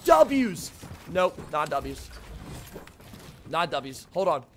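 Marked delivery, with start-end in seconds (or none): 0.0-0.7 loud, high victory shout; celebrating. Real, not acted. Streamer thinks the game is won, celebrating shout. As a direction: whoop it out to the crowd.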